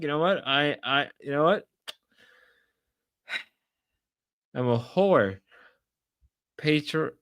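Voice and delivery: ordinary talk, nothing marked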